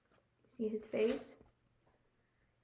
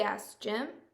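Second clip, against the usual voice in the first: ordinary delivery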